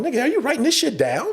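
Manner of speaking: high, eager retold question; incredulous friend. Friend cracking up at his stories, high incredulous question. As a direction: ask it pitched right up, amazed.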